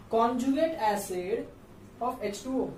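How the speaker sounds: conjugate acid of H two O, spoken slowly; teacher dictating the answer; slow reading aloud. Shown as a demonstration. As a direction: read the full name out slowly. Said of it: "तो क्या बोलना चाहिए".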